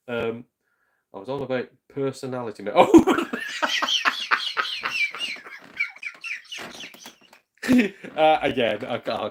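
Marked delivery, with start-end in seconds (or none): 2.6-7.1 loud laughing burst that squeals up high; big laugh. Real, not acted. Streamer cracking up at a chat message, laughing loud.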